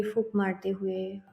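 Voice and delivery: normal talking voice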